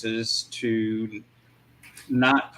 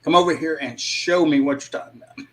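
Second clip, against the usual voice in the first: higher, quick reenacted outburst; retold mock yell; 5 semitones higher, 3 dB louder, faster than usual. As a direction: imitate yourself screaming at someone, half-hearted. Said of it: "screamed at him and said".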